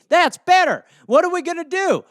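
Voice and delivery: high, loud put-on disbelief; mock shocked official. Official hears Saddam is still alive, high mock disbelief. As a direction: act stunned by the news. Acted dialogue.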